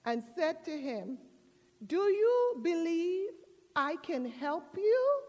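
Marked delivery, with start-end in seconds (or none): 1.9-5.2 raised, measured voice from above; voice from heaven. Retold or imitated. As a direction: call down a solemn question, word by word. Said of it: "a voice came from heaven".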